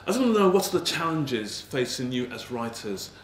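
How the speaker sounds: usual speaking voice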